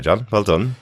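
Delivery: normal talking voice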